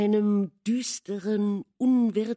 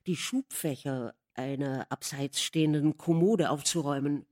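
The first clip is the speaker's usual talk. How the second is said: plain reading voice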